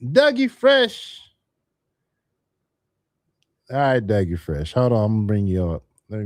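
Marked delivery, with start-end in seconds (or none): 0.0-1.2 drawn-out high hype call. Real, not acted. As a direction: announce the guest like a hype man, slow and high. Host calling guest on stage.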